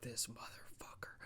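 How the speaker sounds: hissed under the breath; seething whisper to himself; breathy stunned curse; whispered disbelief. Retold or imitated. Aldis realizing the betrayal.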